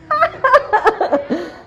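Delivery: a high wild cackle of the possessed boy, hammed up; demonic cackle. Preacher imitating the possessed boy's cackle, high demonic laugh. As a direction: cackle like a demon taking over. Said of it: "Even sound effects here".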